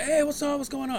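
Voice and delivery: high-pitched friendly hail; upbeat high greeting; friends' greeting. Retold or imitated. Acting out friends' greeting at the gig.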